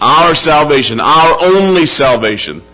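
forceful, ringing sermon delivery; impassioned preaching. Real, not acted. Preacher declaring God alone restores, impassioned preaching. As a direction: preach it out with full conviction.

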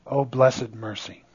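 hushed reverence. Real, not acted. Preacher moved by mercy.

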